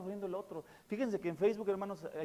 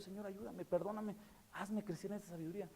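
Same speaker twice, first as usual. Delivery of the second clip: much softer, humble plea; soft prayer; 3 semitones higher, 11 dB quieter than usual. Retold or imitated. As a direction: pray it quietly. Retold personal prayer to God.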